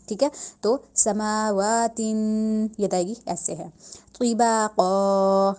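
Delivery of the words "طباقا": طباقا very slowly with a full heavy ط. Shown as a demonstration. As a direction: stretch طباقا with a thick ط. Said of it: "تو کو تا کی sound نہیں دینی".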